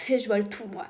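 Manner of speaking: ordinary delivery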